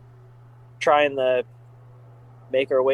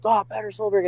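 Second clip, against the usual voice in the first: higher, awestruck name call; freaked-out fan; 7 semitones higher than usual. Retold or imitated. Fan freaking out at seeing Petter Solberg, awed and high. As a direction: gasp his name like meeting a legend.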